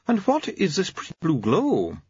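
lightly voiced old doctor; pleased elderly doctor.

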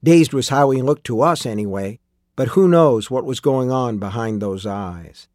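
plain reading voice

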